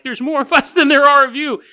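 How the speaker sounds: rally the masses against the rich; mock protest chant; defiant crowd shout. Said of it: "the steerage people are like".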